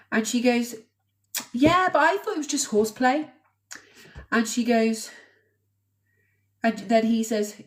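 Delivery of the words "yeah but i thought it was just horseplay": mildly acted protest, pitched up; dismissive retelling. Mother downplaying her son's claim as horseplay, mock dismissive. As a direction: imitate a mother brushing it off, higher.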